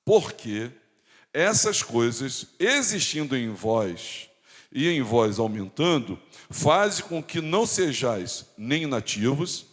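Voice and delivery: slow, deliberate pulpit recitation; emphatic scripture reading. Acted. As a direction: weigh each phrase of the verse slowly. Continues reading the scripture aloud.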